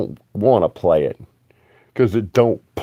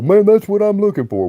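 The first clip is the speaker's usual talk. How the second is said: excited buyer; 11 semitones higher than usual. Retold or imitated. Imagined buyer delighted in store.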